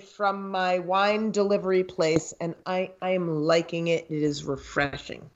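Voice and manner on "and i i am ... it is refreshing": ordinary talk, nothing marked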